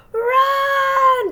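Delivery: very high, loud frantic yell; yelled run. A narrator's reading. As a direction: scream it to escape. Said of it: "Patrick yelled".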